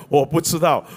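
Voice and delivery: usual speaking voice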